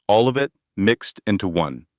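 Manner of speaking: plain reading voice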